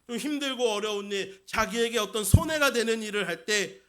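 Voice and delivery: normal talking voice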